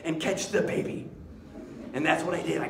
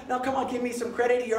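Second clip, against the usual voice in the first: ordinary talk, nothing marked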